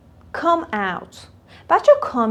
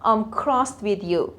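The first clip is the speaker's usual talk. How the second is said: slow model; 3 semitones lower, 6 dB quieter, much slower than usual. Shown as a demonstration. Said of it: "teacher models phrase slowly".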